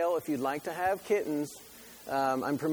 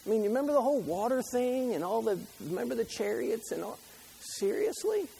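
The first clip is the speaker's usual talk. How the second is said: incredulous God; 7 semitones higher than usual. Retold or imitated. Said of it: "God's like".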